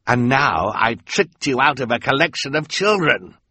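lightly voiced smug villain; gloating villain. A narrator's reading. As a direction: savour the victory. Olaf gloats to captives.

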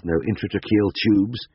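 plain reading voice

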